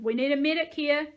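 loud, high call for help; shouted. A narrator's reading. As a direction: shout it urgently across a crowd. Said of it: "someone shouted".

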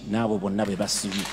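ordinary talk

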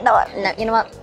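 ordinary talk

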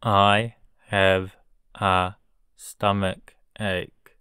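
I have a stomach ache at half speed; slow model sentence. Shown as a demonstration. Teacher modelling a sentence for learners, very slow. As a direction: spell it out slowly for the class. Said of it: "teacher drilling vocabulary".

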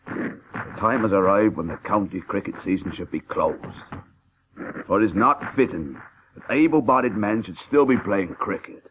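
low, measured, solemn declaration; formal public statement. Acted. The cricketer reads his letter calling for the season's end, solemn and formal. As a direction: read it out like an appeal to the nation.

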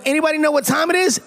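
high, rousing crowd question; hyped-up call. Real, not acted. Guest cueing fans for the catchphrase, hyped-up call. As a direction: pitch it up to rouse the room. Repeats cue to hype crowd.

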